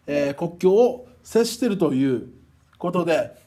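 usual speaking voice

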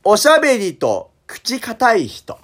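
usual speaking voice

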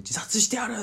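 high-pitched mock scream, not full force; retold scream. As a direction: imitate a woman's desperate cry, held back. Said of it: "叫び声で".

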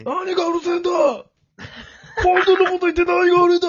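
high, fast shouting rant; ranting train lunatic. Acted. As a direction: rant like a raving stranger. Acting crazy man quarrel on train.

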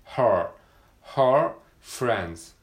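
pronunciation drill; R in her. Shown as a demonstration. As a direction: draw out her with an English R. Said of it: "伸ばし棒のところにR".

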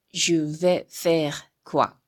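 Je vais faire quoi said slowly with stress on vais, falling; slow French model reading. Shown as a demonstration. Teacher models the French question slowly for students. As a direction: read "Je vais faire quoi?" syllable by syllable for learners. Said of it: "読んでいきますよ".

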